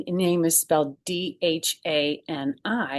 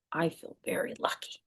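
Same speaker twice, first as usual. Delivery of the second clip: whispered, softer line; hushed whisper of gratitude; 8 dB quieter than usual. Acted. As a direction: breathe it like a little secret. Narrator's quiet feeling line.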